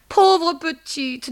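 high, slow lament; pitying landlady. Acted. Madame Dehan pitying her pregnant tenant, high and drawn out. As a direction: coo it high and drawn out with pity.

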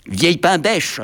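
high, loud cheeky retort; insolent. A narrator's reading. The little locomotive talks back to madame, insolent. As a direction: hurl it back as an insult. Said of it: "répliqua insolemment".

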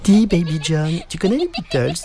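high, insistent schoolgirl questioning; eager little girl. A narrator's reading. Classmate quizzes the supposed English boy, eager. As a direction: pipe up high and keen.

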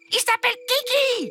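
a bratty little prince listing his wishes; spoiled child prince. Acted. Spoiled little prince lists his wishes, high and whiny.

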